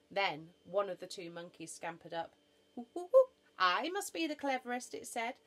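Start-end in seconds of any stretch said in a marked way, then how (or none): 2.8-4.9 high, loud monkey hoots into a boast; pitched-up chattering animal; excited monkey. Acted. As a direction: scamper in, eager to show off.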